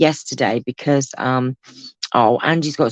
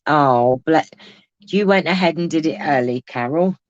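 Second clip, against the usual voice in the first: normal talking voice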